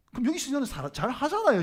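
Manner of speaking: ordinary delivery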